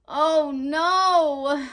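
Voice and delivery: gasped. Acted. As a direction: catch your breath in shock. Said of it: "Ava's mother gasped".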